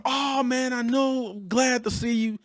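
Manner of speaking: high, falsely friendly greeting; three dollar bill fake welcome; a hint of a phony boss. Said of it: "Fake, fake, fake".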